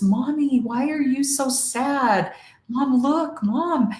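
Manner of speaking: put-on a worried eight-year-old begging his mom to look; little boy's voice. Little son voiced by mother.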